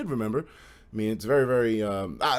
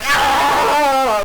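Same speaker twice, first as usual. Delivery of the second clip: a loud, high, furious yell; angry yell; 10 semitones higher, 11 dB louder than usual. Retold or imitated. Friends bursting into the room, yelling in rage. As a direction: burst through the door yelling. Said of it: "they're just like".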